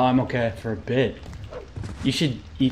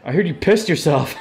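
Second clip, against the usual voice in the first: higher teasing voice breaking into laughter; mocking laugh; 6 semitones higher, 3 dB louder than usual. Gamer voicing a bully teasing the kid, mocking laugh. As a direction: jeer at the kid, then laugh.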